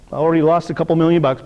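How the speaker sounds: ordinary delivery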